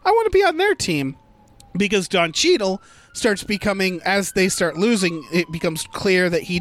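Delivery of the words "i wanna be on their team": put-on high, fast little kid voice. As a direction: chirp it like an eager kid. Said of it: "the kid is like".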